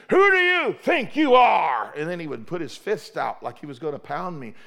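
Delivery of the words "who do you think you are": slow, loud, high furious shout; bully yelling in the pastor's office; bully's shout. Retold or imitated. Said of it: "he would stand up in my office and say".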